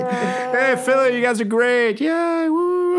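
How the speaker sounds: high mock cheer for Philly; sarcastic cheer. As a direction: cheer with fake enthusiasm.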